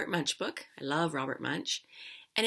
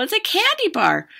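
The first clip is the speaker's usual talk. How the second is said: high, louder exclamation of discovery; excited girl; 13 dB louder than usual. A narrator's reading. Girl spotting a candy bar on the ice, excited. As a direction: pipe up high, thrilled at a find.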